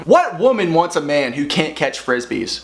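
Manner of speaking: normal talking voice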